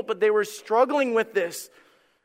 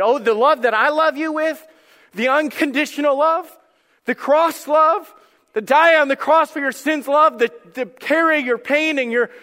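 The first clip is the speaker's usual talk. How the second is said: higher, louder, building fervor; impassioned preaching; 8 semitones higher, 6 dB louder than usual. Acted. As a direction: preach it with mounting force as God's answer. Voices God's impassioned reply.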